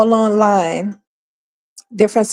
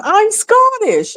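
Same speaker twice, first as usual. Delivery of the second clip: pitched way up, slow and stubborn; high, slow mock insistence; 12 semitones higher, slower than usual. Vlogger mocking an immigrant repeating the same answer, high and slow. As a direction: stretch out "I'm Scottish" like a smug broken record. Acted back-and-forth quarrel.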